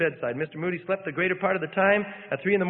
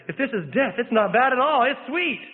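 rising joy; 7 semitones higher, 4 dB louder than usual. A narrator's reading. Dying man's joyous words.

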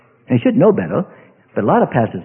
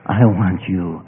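dropped low and soft, yearning; low tender longing; 12 semitones lower, 3 dB quieter, much slower than usual. Acted. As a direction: murmur it to a sweetheart. Acted lovers' talk.

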